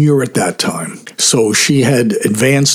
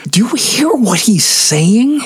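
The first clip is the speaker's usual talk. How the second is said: retold disbelief, much higher in pitch; incredulous; 10 semitones higher than usual. Singer checking with friends between songs, incredulous. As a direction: ask it as if you can't believe your ears.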